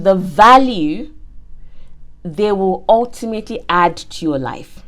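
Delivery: deliberate preacher emphasis; slow emphatic. Real, not acted. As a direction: spell the point out slowly and firmly. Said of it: "slow emphatic point".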